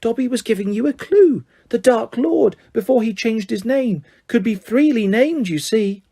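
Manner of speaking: high elf voice explaining eagerly. A narrator's reading. Elf explaining his clue to Harry, eager. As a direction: spell it out as if it were obvious.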